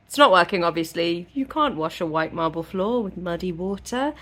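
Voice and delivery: ordinary talk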